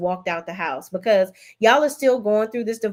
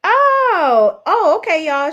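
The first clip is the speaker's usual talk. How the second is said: high, loud excited cry of oh; excited squeal; 9 semitones higher, 8 dB louder, much slower than usual. Real, not acted. Streamer opening her friend's news: high, loud excited cry. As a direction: break into a real delighted squeal. Opens the friend's surprise message.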